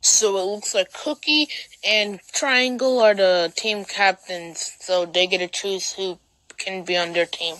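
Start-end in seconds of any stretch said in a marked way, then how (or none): none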